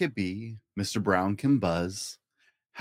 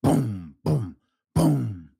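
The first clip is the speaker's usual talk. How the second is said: louder thunder booms; 2 semitones higher, 4 dB louder, much slower than usual. Acted. Dad reading to a child, thunder booms. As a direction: boom like thunder. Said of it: "Mister Brown makes thunder".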